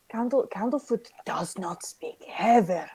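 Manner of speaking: confiding whisper. Acted. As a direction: whisper it as a discreet secret. Mona confides to companions.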